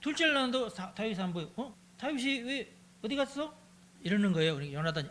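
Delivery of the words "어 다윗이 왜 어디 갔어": surprised question. Acted. Saul notices David missing.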